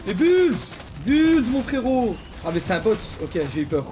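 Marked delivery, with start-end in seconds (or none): 0.1-2.2 plus haut et plus fort, bulles étiré lentement; appel excité. Real, not acted. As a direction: crie pour réclamer les bulles. Réclame les bulles en jeu. (appel crié, lent et fort)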